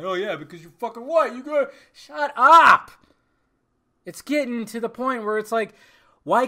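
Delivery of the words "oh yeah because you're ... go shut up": mocking angry critic. Podcaster mocking critics who attack him, mock angry voice. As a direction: sneer it like an angry commenter, then bark the end. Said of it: "people that probably be pissed off at me".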